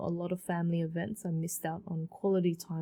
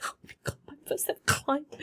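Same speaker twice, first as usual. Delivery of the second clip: whispered gasp breaking into a teary high cry; overwhelmed joy; 8 semitones higher, faster than usual. Retold or imitated. Past self after hanging up, whisper then teary cry. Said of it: "I was like".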